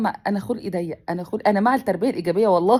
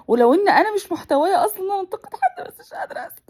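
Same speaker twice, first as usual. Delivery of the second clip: tearful; 9 semitones higher, faster than usual. Real, not acted. Emotional rant, crying.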